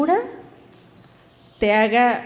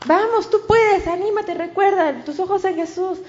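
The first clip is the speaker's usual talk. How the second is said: encouraging; 6 semitones higher than usual. Pretend. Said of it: "debería decirle".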